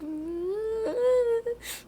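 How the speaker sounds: high hammed-up fake wail; mock wailing sob. Imitating the girl's fake cry to everyone, high mock wail. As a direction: wail like someone faking pain. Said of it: "She's like".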